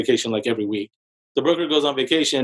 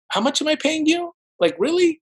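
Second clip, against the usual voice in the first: high, pitched-up disbelieving retort; incredulous disbelief; 13 semitones higher than usual. Retold or imitated. Venting about the lazy realtor, high incredulous disbelief. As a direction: retell it with your jaw on the floor.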